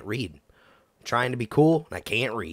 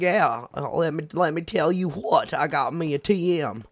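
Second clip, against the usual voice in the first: higher, hammed-up southern twang; mock southern accent; 6 semitones higher, 4 dB louder than usual. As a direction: imitate yourself going all southern. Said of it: "I just went southern right there".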